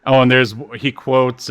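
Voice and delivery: usual speaking voice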